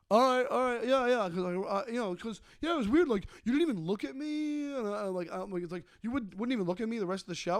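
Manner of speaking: a hint of a rambling excuse-maker; mimicking the heckler. Comic retelling the heckler's flustered rambling excuse. Heckler imitated in retold story.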